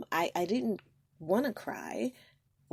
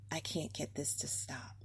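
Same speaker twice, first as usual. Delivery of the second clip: hushed despair; 3 semitones lower, 7 dB quieter than usual. Retold or imitated. Parent's helpless inner voice.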